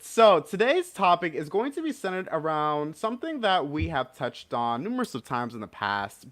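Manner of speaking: ordinary delivery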